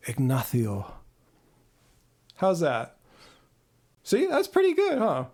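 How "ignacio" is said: Ignacio low, with a Castilian "th" for the c; Spanish flair. Shown as a demonstration. Streamer demonstrating Ignacio for viewers, low Spanish accent with "th". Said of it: "with the Spanish flair".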